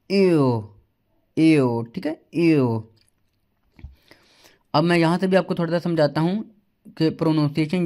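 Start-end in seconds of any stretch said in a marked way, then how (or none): 0.1-2.8 एओ with rounded lips and an e tongue; German umlaut o. Shown as a demonstration. Teacher repeating the umlaut ö sound. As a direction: glide एओ as one rounded vowel. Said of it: "इसको कहेंगे हम".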